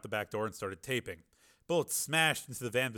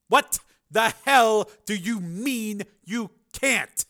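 slow, loud furious bellow; enraged shout; 11 semitones higher, 11 dB louder, much slower than usual. A narrator's reading. Commander raging at launch officer by phone, furious shout. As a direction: roar it word by word, pitched up.

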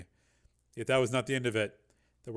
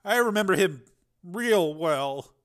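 higher, slow, bitter recollection; 6 semitones higher, 7 dB louder, slower than usual. A narrator's reading. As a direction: dwell bitterly on the memory. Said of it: "said Jason bitterly".

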